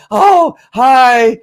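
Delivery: high, loud, gushing happy-dog voice; flamboyant dog voice. Retold or imitated. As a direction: play the dog greeting its owner with glee. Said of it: "I go to a little bit of flamboyant".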